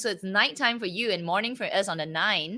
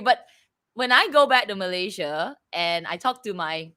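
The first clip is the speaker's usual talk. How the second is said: ordinary delivery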